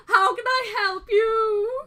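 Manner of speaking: high, slow, sobbing greeting; overdone weepy merchant voice; old dude gushing at customers; flamboyant shopkeeper. Acted.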